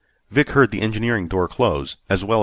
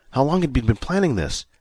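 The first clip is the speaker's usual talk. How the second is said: plain reading voice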